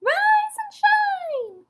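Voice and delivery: very high, loud, drawn-out squeak; piercingly high frog shout; shouting little frog. A narrator's reading. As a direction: shout it as a tiny frog wake-up call. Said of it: "he shouted".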